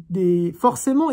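ordinary talk

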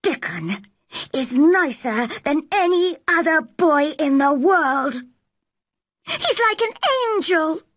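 high-pitched heated defence; furious girl. Acted. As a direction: defend your friend hotly, pitch rising. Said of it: "Mary had never been so furious".

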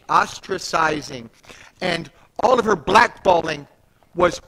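loud, building preaching climax; rising pulpit fervour, slowed on key words; full-force preaching. Acted. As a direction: preach it out, hammering each phrase.

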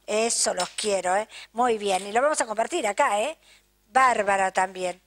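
ordinary delivery

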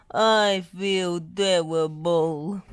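slow, bunged-up moan; miserable, drawn-out sick voice; wheezing with a cold. A narrator's reading. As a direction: drag it out like someone full of cold. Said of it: "wheezed Bob".

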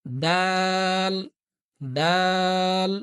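দাল very slow and held long; drawn-out dal. Shown as a demonstration. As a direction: stretch দাল for pupils. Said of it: "এটা হচ্ছে".

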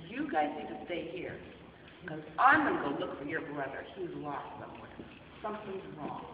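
low, soft serious instructions; quiet worried shepherd; low earnest shepherd. Acted. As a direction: tell the flock to stay put. Shepherd tells flock quietly.

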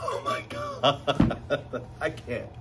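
slow oh my God dissolving into a laugh; giggle, overcome with delight; breathless happy laugh; laughing in disbelief. Real, not acted. Overwhelmed by new bag.

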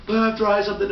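usual speaking voice

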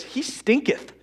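high, mock-pious scripture quote; mock holy. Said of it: "Everything's a little holier when you say".

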